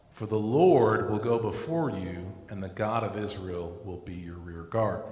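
solemn, measured reading of scripture. Acted. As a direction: read it out like a prophecy. Reads Isaiah scripture aloud.

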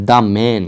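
The man, "The" said "daa". Shown as a demonstration. Teacher answering how it is pronounced, "daa man". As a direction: open "The" to "daa" before man. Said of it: "তাহলে এটা কি হবে?".

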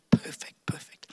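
hushed whisper of awe; soft whispered repetition. Real, not acted. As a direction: breathe the words out in a reverent whisper. Preacher stresses God's timing.

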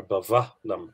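"Bavahlam" with a v sound; other dialect. Shown as a demonstration. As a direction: say "Bavahlam" with v, not w.

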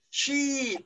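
high, loud drill call with a falling end; loud, forceful. Shown as a demonstration. Teacher urging the class to say it loudly, forceful call. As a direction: belt it out as a model to copy. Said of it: "Bật to, bật mạnh lên nào".

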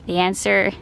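ordinary delivery

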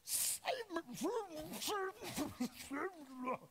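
high, strained cry for help; mock sinking man crying out; mock panicked plea. Peter sinking and calling for help, mock panic. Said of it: "But here he is".

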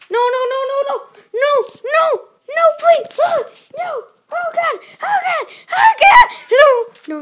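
high, loud panicked shrieks with a laugh; panicked screaming. Real, not acted. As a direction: scream no and oh God, half laughing. About to die in game.

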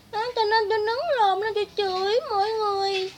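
very high, loud mock-crying voice; hammed-up wailing caricature of a rival; Nhã Linh Miêu. Speaker posing as rival pleading to viewers, mock wailing. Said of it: "tôi là Nhã Linh Miêu nè".

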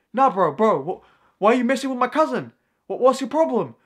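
high, loud challenge; mock confrontation. Kid confronts him over his cousin, aggressive mock challenge. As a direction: imitate an aggressive kid demanding answers.

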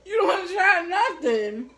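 high and loud, laughing while teasing. Real, not acted. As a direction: tease through a laugh. Teasing friend mid-challenge.

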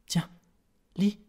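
groaned short order; moaning. A narrator's reading. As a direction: moan it weakly to your wife. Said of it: "gémit le peintre". (gémissant)

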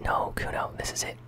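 ordinary talk

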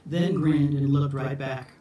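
ordinary delivery

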